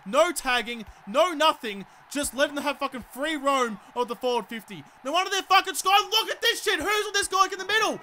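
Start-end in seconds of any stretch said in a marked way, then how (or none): 5.0-8.0 high-pitched, furious rant; really angry. Real, not acted. Gamer fuming as an unmarked player gets the ball, angry rant. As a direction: let the outrage spill out high. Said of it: "I'm so angry".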